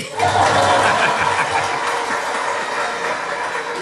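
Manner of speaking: long breathy laughing fit; unvoiced real laughter. Real, not acted. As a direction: crack up at your own line. Laughs after his punchline.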